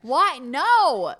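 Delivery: loud, high disbelief; outraged shout. Real, not acted. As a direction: yell it in disbelief.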